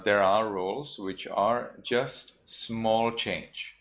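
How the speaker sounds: normal talking voice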